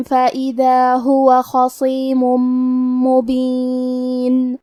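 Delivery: slow drawn-out tajweed chant; Quran recitation. Acted. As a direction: recite slowly, stretching each vowel. (ترتیل کے ساتھ تلاوت)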